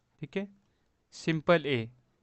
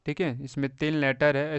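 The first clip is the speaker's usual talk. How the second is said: ordinary talk, nothing marked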